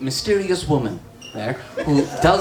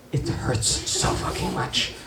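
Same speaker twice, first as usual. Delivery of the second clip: sigh, then a breathy near-whisper; pained hush; 4 semitones higher, 7 dB quieter than usual. Acted. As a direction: let the pain out in a hushed breath. Confides how painful it is.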